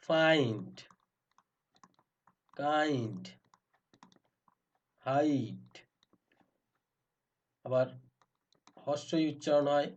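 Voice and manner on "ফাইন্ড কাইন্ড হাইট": "ফাইন্ড, কাইন্ড, হাইট" with long ai glide; drawn-out "ai" vowel. Shown as a demonstration. Teacher modelling the I sound as ai, held long. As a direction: hold the ai in each word, pausing between. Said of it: "এটা আই উচ্চারণ".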